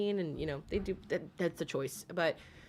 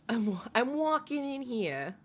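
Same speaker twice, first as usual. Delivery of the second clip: higher, drawn New York impression; New York accent; 4 semitones higher than usual. Streamer riffing for a New York viewer, mock New York accent. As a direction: play the New York pedestrian. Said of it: "You live in New York?".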